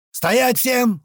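high-pitched shouted order; yelled command. A narrator's reading. Man halting an armed standoff, high shouted command. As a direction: yell to freeze everyone. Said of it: "заорал я".

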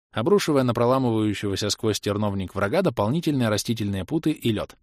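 plain reading voice

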